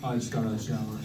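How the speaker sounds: hint of a low, oblivious voice; nonchalant brother. Toast speaker imitating his brother on the phone, nonchalant. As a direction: lightly imitate a clueless, unbothered brother.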